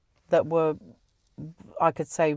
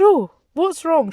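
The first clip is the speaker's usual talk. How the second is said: higher, louder, slowed with alarm; startled and concerned at her reaction; alarmed question; 11 semitones higher, 7 dB louder than usual. Retold or imitated. Asks the friend in alarm what is wrong, high and loud. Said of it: "I said".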